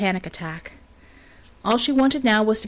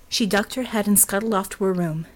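ordinary delivery

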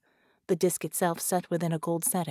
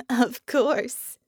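chuckled; 6 semitones higher, 6 dB louder than usual. A narrator's reading. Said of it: "she chuckled".

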